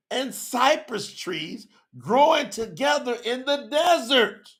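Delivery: high, slow, ringing preacher's reading; proclaimed scripture. Acted. Preacher voicing Yahuwah's promise of rivers, slow high proclamation. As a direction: draw out each phrase, pitched high.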